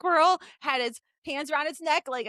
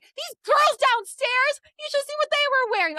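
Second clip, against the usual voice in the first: mock rant; 9 semitones higher than usual. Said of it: "You're like".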